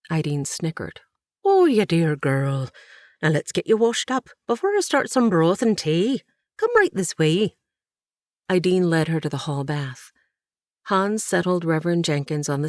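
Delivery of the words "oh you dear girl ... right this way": a touch higher and louder, motherly bustle; a lightly voiced welcoming aunt; kindly aunt. A narrator's reading. Ideen ushering the tired girl to wash, lightly motherly.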